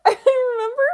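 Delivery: squealed through laughter, very high and loud; laughing. Real, not acted. Streamer remembering something really funny, bursting with laughter. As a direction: crack up as the memory hits. Cracks up recalling a funny conversation.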